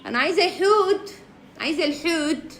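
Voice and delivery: put-on high babyish I want; childish whine. Streamer whining like a child to a viewer. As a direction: whine like a spoiled kid.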